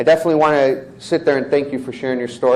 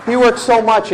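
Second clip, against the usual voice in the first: loud, high crowd hype; hyped-up cheer; 10 semitones higher, 7 dB louder than usual. Real, not acted. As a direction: repeat it to fire up the room. Repeats it even higher.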